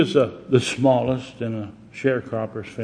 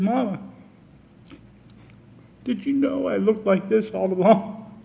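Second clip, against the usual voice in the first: put-on a light child voice, voice rising on Mama; hint of a hurt little boy asking; little boy's voice; 9 semitones higher than usual. Scarred Willie asks his mama, lightly childlike. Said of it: "gasped in horror".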